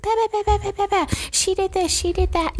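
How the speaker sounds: high yammering gossip voice; mocking gossip. Staff gossiping about each other, high mock yammer.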